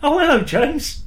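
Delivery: high, put-on hello; mock greeting. Co-host pretending to start the chat, high mock greeting. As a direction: greet him in a high silly voice.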